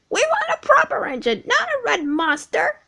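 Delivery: very high, fast squeaky truck voices; mock the red engine; jeering trucks. Trucks jeer at James.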